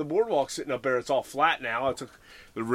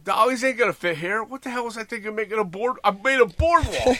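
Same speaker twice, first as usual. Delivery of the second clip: exasperated rant; 8 semitones higher than usual. Retold or imitated. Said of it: "And I'm like".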